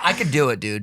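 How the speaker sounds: ordinary talk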